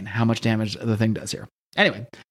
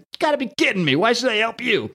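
incredulous Thing voice; 9 semitones higher than usual. Retold or imitated.

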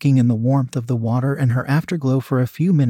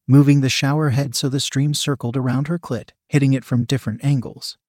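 ordinary delivery

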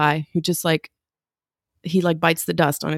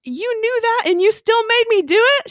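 high-pitched, incredulous squawk; hammed-up indignant outburst; mock outrage; 3 dB louder than usual. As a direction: accuse your friend in comic disbelief. Retold outrage at captain's confession.

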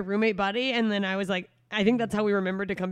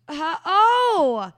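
higher, louder startled uh-oh; mock alarm; 8 semitones higher, 11 dB louder than usual. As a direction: act suddenly alarmed. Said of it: "they hear that and they go".

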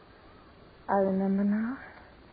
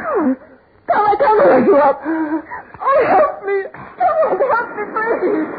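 high, loud tearful cry for help; a wife who can't hold her fainting husband, crying; 9 semitones higher, 6 dB louder than usual. Acted. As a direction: sob it out, desperate.

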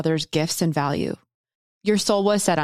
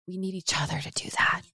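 whisper; 7 dB quieter than usual. Real, not acted. Emphatic line on needing each other.

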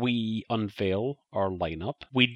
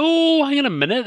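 even higher, louder dawning alarm; surprised; 8 dB louder than usual. Real, not acted. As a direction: build the surprise further. Sudden realisation, mock alarm.

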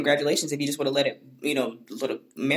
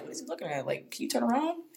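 subtle mutter; 6 semitones higher, 9 dB quieter than usual. Retold or imitated. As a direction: mumble it softly so he won't catch it. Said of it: "a little bit more subtle about it".

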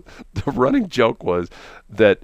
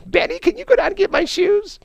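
mocking falsetto plea; 4 dB louder than usual. Officers mocking Benny with the secretary's line, high falsetto. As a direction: rib him with a squeaky lady voice. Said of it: "they just always say that to him".

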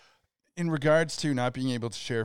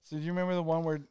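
ordinary talk, nothing marked

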